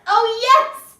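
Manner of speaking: high, loud cheer of success; thrilled, pitched right up; triumphant yelp. Real, not acted. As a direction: yelp as the trick works. The handstand pose finally works.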